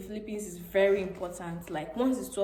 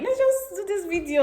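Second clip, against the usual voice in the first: higher, louder pitch-up of the idea; eager self-quote; 10 semitones higher, 5 dB louder than usual. Retold or imitated. Telling how she came to see her friend, eager self-quote. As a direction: quote yourself pitching it, brightly raised. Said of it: "I'm like".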